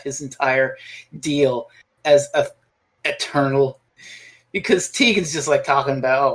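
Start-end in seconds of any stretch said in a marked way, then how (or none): none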